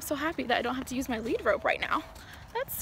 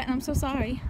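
normal talking voice

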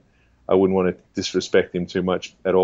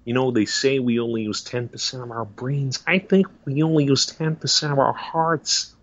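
movie-star impression, lightly raised pitch; Owen Wilson; 4 semitones higher than usual. Actor musing on brains and hearts, wondering impression. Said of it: "one of the popular ones is Owen Wilson".

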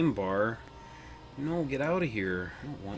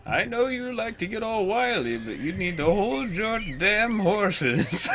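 put-on slow old wizard voice, higher; 7 semitones higher, 4 dB louder, slower than usual. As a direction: scold like a creaky old wizard. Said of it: "Mama Nook's wizard voice will haunt me".

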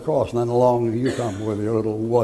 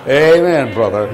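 loud amen; 2 semitones higher, 9 dB louder than usual. Real, not acted. Crowd-pleasing response after laugh.

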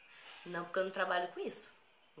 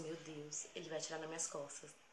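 soft, low inner voice; hushed dread; 3 semitones lower, 11 dB quieter than usual. Retold or imitated. Girl creeping past robber, hushed fearful thought. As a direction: say it under your breath.

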